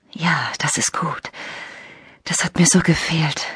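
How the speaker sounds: soft unvoiced whisper into the phone. Lena whispers to Daniel on the phone, intimate whisper. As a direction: breathe the words close to the mouthpiece.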